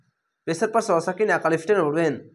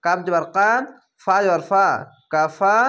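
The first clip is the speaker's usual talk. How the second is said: ordinary talk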